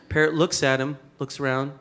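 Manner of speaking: ordinary talk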